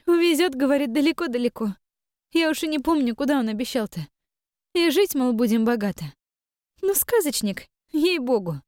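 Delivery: high, eager rapid prattle; chattering young woman. A narrator's reading. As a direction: chatter on to hide the pain. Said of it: "Девушка всё тараторила".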